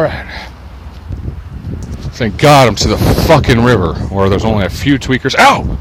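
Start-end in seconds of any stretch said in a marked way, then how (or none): 5.4-5.8 sudden high "ow"; real yelp at a sharp prick; pained yelp. Real, not acted. Walker stung by stickers in weeds, pained yelp.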